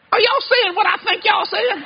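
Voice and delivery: high, slow, stunned question; can't believe what he's hearing; shocked disbelief. Retold or imitated. Preacher to the two women, high and slowly stunned.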